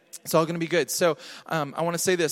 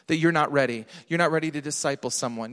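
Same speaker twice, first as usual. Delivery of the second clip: ordinary talk, nothing marked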